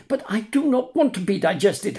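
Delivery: high, fast wail of a frightened puppet; howling, near tears. Acted. Puppet protests to the tunny, howling and near tears. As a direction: wail against being digested. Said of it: "howled Pinocchio, beginning to cry".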